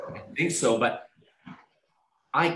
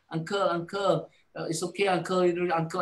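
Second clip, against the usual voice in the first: soothing nurse; 6 semitones higher than usual. Retold or imitated. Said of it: "the nurse was saying".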